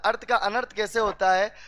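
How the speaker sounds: usual speaking voice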